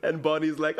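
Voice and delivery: normal talking voice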